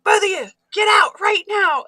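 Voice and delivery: high, loud shouted command; mock furious order. Mom ordering bickering kids out of car, shouting. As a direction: yell it like a fed-up parent, retold.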